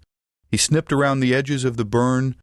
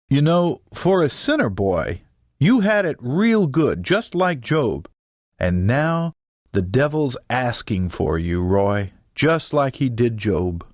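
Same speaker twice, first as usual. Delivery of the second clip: swooping preacher cadence, weighted pauses. A narrator's reading. Mama aims her sermon at sinner Roy, preacher voice. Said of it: "aiming her preacher voice directly at Roy".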